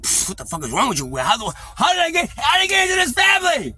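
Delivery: starts normal, ends in very high loud shout; exasperated kid building to yell. Retold or imitated. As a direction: explode in disbelief. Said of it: "I was like".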